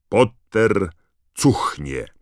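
loud, punched words with a pause between; proclaimed insult. A narrator's reading. The badge reveals its slogan, loudly proclaimed insult. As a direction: announce the insult word by word. New mocking slogan read out.

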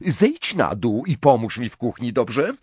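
plain reading voice

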